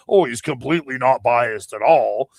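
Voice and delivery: mocking sarcastic impression of a biased claim; sarcastic mock quote. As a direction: sneer it as if nobody would believe it. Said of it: "say, like".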